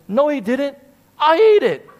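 a hint of mom owning up; mom confessing. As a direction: mom admitting she ate the treat.

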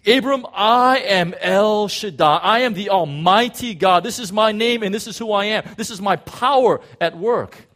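a loud, commanding voice of God; God declaring. Acted. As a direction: call out his name like God himself. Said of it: "He says".

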